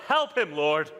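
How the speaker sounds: mild mock yell; retold yell. Churchgoers reacting to a bad sermon, mock yell. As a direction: call it out like a congregation heckler. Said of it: "they would yell".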